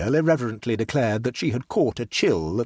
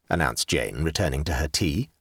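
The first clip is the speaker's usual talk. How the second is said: plain reading voice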